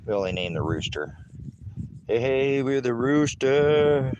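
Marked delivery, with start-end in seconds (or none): 2.1-4.1 high, bright pet praise; praising the hens. Real, not acted. Farmer doting on the hens, high bright praise. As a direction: dote on the hens.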